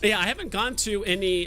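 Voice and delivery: ordinary delivery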